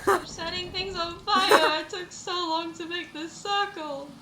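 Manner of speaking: a half-laughing, high, loud wail; yelling in mock despair; frantic cry. Real, not acted. In-game fire mishap, crying out.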